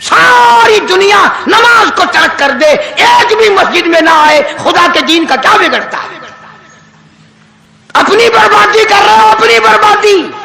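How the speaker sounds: high-pitched, ringing sermon delivery; fiery admonition. Acted. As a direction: cry out the warning to the congregation. Preacher admonishes at full force. (पुरज़ोर नसीहत)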